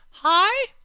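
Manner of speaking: very high, drawn-out falsetto "high"; high pitch. Shown as a demonstration. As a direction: say HIGH up in a squeaky-high register. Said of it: "Tone is simply the pitch".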